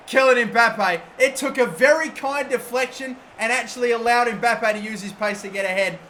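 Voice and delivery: ordinary talk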